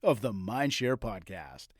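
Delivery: big, upbeat podcast announcer intro. Acted. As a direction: sell the show intro like a radio host. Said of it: "I really need to step up my announcer voice so that I sound that good".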